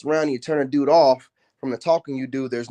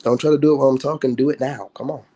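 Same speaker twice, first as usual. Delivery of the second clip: ordinary delivery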